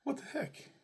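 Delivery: high-pitched mock shock; shocked parents. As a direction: squeak it out in disbelief. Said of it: "we're like".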